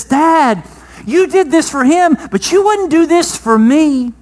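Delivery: put-on the jealous older brother whines at Dad; hurt older son. Older son protesting to his father, hurt and pleading.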